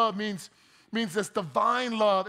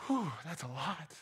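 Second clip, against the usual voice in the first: tired sigh; 6 semitones lower, 10 dB quieter than usual. Real, not acted. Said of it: "I'm just tired reading this".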